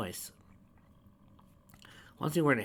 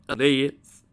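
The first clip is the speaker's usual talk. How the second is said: awkward; 5 semitones higher, 12 dB louder than usual. A narrator's reading. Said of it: "he said awkwardly".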